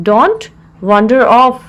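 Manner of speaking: measured, with a clear mid-phrase pause; broken into parts. Shown as a demonstration. Teacher repeats the phrase for learners, in separated parts.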